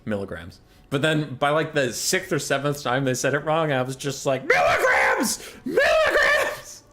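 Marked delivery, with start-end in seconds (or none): 0.1-0.6 low, soft muttered correction; under the breath. Retold or imitated. Driver correcting the podcast the first time, under the breath. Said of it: "under my breath, I was like". 4.5-6.8 very high, drawn-out shriek; exasperated scream. Retold or imitated. Driver after the sixth or seventh wrong unit, exasperated scream.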